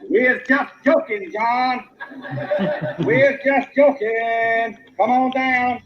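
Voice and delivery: coaxing call up a tree. Retold or imitated. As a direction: laugh while calling him down.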